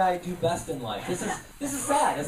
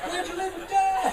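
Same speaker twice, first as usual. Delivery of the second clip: mock concerned heckler; 11 semitones higher than usual. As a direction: ask like a fretful social worker. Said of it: "That is my favorite heckle".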